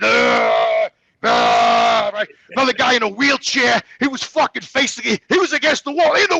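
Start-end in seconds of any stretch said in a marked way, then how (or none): 0.0-2.1 mock groan. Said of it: "up against the wall going like".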